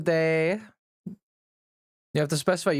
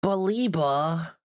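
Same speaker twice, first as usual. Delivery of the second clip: whiny complaint; 4 semitones higher, much slower than usual. Acted.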